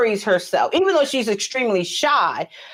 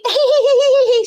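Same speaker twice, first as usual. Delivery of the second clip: a high, girlish mock giggle; imitated cute giggle; 5 dB louder than usual. Mother imitating her daughter's cute laugh, high giggle. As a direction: giggle the way she does. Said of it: "she had such a cute laugh".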